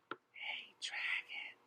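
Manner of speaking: whispered very softly. A narrator's reading. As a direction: test if he is asleep, barely a breath. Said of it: "Elizabeth whispered very softly".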